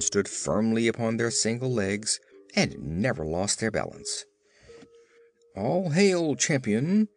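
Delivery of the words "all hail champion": higher-pitched hailing cry; calling out. A narrator's reading. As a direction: hail the champion, raising the pitch. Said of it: "cried a man in the first group".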